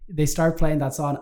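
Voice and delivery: usual speaking voice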